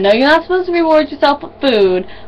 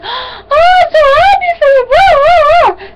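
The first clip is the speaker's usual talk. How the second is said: hammed-up happy squeal, very high; exaggerated high-pitched cheering; Cassie; 5 dB louder than usual. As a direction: squeal with joy like an overexcited friend. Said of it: "that's what Cassie sounds like when she's happy".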